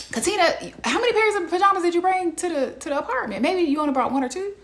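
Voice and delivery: high, fast, baffled questions; incredulous questioning. Acted. Host asks Katina about her pajamas, high incredulous grilling. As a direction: fire the questions off in disbelief.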